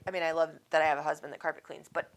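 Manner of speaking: ordinary delivery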